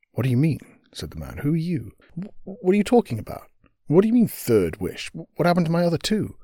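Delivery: a flustered man who can't follow what's happening; bewildered man. Acted. Man confused by the witch.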